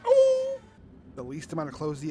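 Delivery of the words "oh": surprised shout. Real, not acted. Sudden shock.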